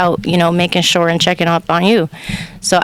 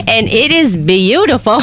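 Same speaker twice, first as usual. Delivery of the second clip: higher, louder Southern accent; 5 semitones higher, 3 dB louder than usual. Shown as a demonstration. Running it back for the host, Southern accent. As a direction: put on the Southern charm for show. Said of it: "can you run that back".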